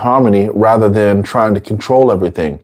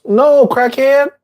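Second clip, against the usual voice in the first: much higher and slow, playfully blunt no; blunt mock refusal; 14 semitones higher, slower than usual. Teacher acting a friend refusing the crackhead, high and blunt. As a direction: slap the word down, high and slow.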